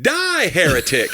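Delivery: slow, high, loud hammed-up cry of Die, heretic; mock zealot cry. Joke punchline to his cohost, a hammed-up fanatic's cry. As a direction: bellow the verdict like a mock fanatic.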